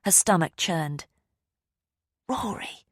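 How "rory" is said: soft, hollow realisation; the name sinks in; stunned murmur. Acted. Ava realises in horror.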